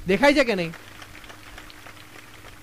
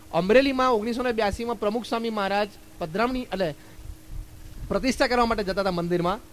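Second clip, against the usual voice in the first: ordinary talk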